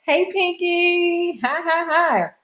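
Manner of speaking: high, drawn-out friendly call; sing-song greeting. Real, not acted. Host greets Pinky joining the stream, high sing-song hello. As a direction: sing out hello to an arriving viewer.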